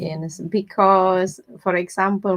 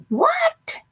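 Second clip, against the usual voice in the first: squeaky very high disbelieving what; a cartoonish gasp of disbelief; shocked what; 4 dB louder than usual. Retelling her reaction to the neighbor, a squeaky shocked what.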